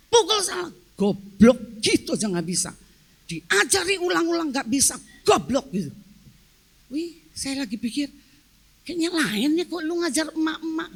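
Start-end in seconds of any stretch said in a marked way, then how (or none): 0.1-5.9 punchy, mildly hammed-up telling-off; mock angry scolding. Husband scolding his wife at tennis, mock angry. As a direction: imitate an exasperated coach's loud bursts. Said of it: "Uh, marah dia".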